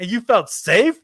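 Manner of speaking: slow, high-pitched disbelief; incredulous question. Retold or imitated. Man asking a woman about meeting a stranger, incredulous. Retold disbelief at her story.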